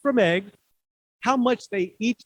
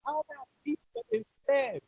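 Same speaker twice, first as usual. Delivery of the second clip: much higher, softer little owl voice; Al's suggestion; 9 semitones higher, 5 dB quieter than usual. Acted. The owl offers the dragon pizza, a high small voice. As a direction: pipe it up like a small hopeful owl. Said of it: "said Al".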